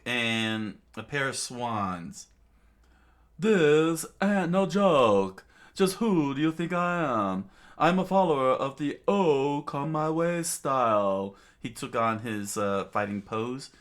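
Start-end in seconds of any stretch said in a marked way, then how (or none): 3.4-11.3 theatrical boastful fighter. A narrator's reading. Bon Clay posing before his opponent, boastful and loud. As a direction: declare it with showy bravado.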